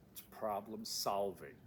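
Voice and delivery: solving weighted and slow; slow, firm solving at the end; stress on solving. Shown as a demonstration. Instructor telling students the key, firmly. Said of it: "you wanna know how to succeed".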